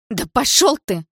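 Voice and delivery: high-pitched angry outburst; furious cry. A narrator's reading. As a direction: fling it at him in rage. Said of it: "выкрикнула я в ярости".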